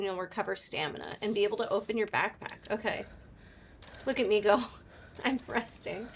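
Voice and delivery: normal talking voice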